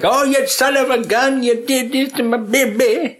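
high, hammed-up wail of outrage; mock father-in-law. Grieving father-in-law at the funeral, hammed-up high wail. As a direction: shriek it like an overacting grieving dad. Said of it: "his father-in-law".

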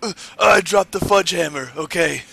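high, mock-panicked confession; hammed-up nervous excuse; Triple H impression. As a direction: start high and loud, easing off at the end. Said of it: "you do the best, uh, Triple H".

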